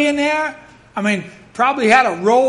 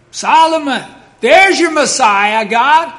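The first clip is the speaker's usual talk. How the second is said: loud, slow taunting devil, pitched higher; devil gloating; 4 semitones higher, 6 dB louder than usual. Retold or imitated. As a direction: gloat over God like a triumphant devil.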